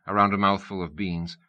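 usual speaking voice

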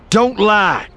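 high, loud angry snarl. A narrator's reading. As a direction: spit it out furiously. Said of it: "Eli snarled".

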